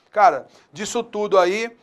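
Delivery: usual speaking voice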